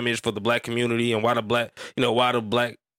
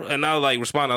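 normal talking voice

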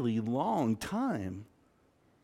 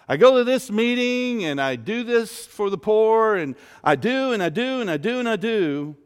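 mock self-righteous listing, higher pitched; self-righteous boasting; 6 semitones higher, 4 dB louder than usual. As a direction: pile up the good deeds, imitating a boaster. Voicing the self-righteous son listing deeds.

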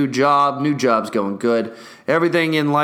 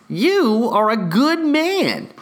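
higher and slow; hammed-up thanks; slow gushing praise; 8 semitones higher, slower than usual. Real, not acted. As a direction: gush with gratitude.

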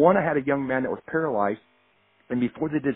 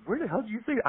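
high mock-exasperated wife voice, a little softer; imitating wife; 10 semitones higher, 7 dB quieter than usual. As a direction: play his wife, pitched up and half-laughing. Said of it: "and my wife goes".

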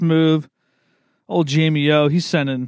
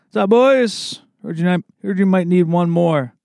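cocky walk-on greeting; 4 semitones higher than usual. Pretend. Greets rookie team at tryout.

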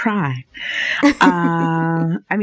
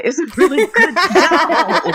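laughing; 10 semitones higher, 5 dB louder than usual. Real, not acted. Recommending show, laughing.

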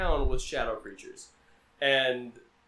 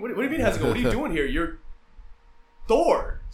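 louder, higher mock disbelief; baffled Thor; 6 semitones higher, 4 dB louder, faster than usual. Thor reacts to Jane with mock disbelief. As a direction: act stunned and confused.

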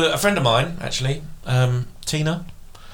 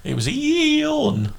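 teasing; slower than usual. Real, not acted. Teasing insistence.

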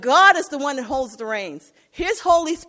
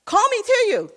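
eager Peter; 8 semitones higher, 4 dB louder than usual. Retold or imitated. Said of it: "he sees him out on the water".

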